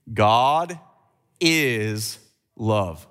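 slow emphatic congregation response; God is love said slowly. Shown as a demonstration. Preacher leading his church in God is love. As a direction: lead the crowd on God is love. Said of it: "can you say the next three words with me".

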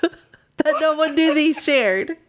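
words broken by giggles; laughing through it. Real, not acted. Friend telling her silly ending, laughing through words. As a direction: fight the laughter while telling. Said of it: "laughing through own ending".

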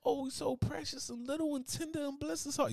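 high, sugary polite gush; fake sweetness. People praising an ugly baby, high sugary gush. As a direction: gush sweetly to hide the insult.

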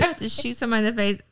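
rattled off very fast; fast mock quote. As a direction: blurt it out in a rush. Mock quote of the cop line.

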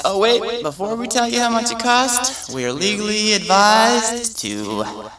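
high, chirpy ad announcer; breezy disclaimer, too upbeat; cheerful ad with eerie menace. Retold or imitated. Mock ad legal disclaimer.

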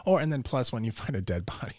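normal talking voice

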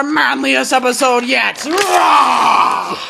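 loud, high macho roar; manly shout. Acted. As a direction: bellow like ripping your shirt off. Manly shout, shirt ripping.

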